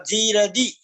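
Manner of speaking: जीरदि with a long stretched जी and a short दी; long vowel shown. Shown as a demonstration. Teacher showing students the long जी in जीरदि. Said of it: "जी की तो दीर्घ मात्रा है और दी की ह्रस्व मात्रा है".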